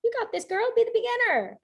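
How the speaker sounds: high, sing-out cheerleading angel; angel on the shoulder. Acted. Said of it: "the angel was like".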